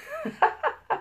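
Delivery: short high-pitched chuckle; a brief, soft laugh at your own remark. Real, not acted. Laughs after own nail-work joke.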